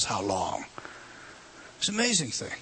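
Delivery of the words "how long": low, soft, aching how long; a deep, quiet lament to the Father; anguished cry. Acted. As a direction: let how long sink low and hushed in grief. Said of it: "Jesus who cries out and says".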